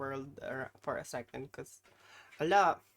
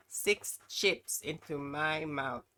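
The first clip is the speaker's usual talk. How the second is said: slow build-up; much slower than usual. Real, not acted. Announcing chip challenge.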